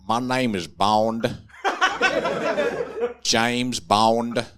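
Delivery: a Louisiana guy playing Bond, Southern accent, laying it on thick; deep Southern accent. Comic imagining a Louisiana man as James Bond, in a Southern accent. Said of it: "with a deep Southern accent the entire time".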